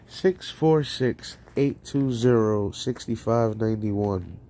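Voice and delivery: ordinary delivery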